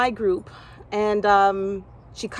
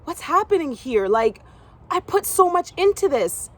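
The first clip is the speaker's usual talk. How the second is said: bewildered; 7 semitones higher than usual. Retold or imitated. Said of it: "And I'm like".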